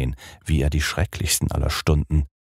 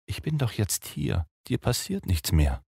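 mitleidig; 5 semitones higher, 6 dB quieter than usual. Acted. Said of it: "meinte sie mitleidig".

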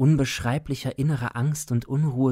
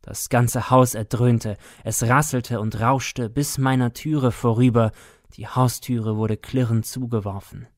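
plain reading voice

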